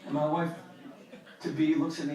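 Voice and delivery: ordinary delivery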